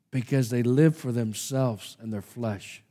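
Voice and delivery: ordinary talk, nothing marked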